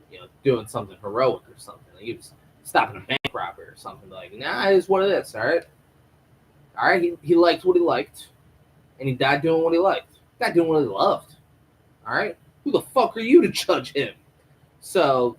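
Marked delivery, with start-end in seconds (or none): 4.3-14.1 higher, louder defiant defense; defensive relative. Acted. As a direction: stand your ground, a touch indignant. Mock relative defends dead brother.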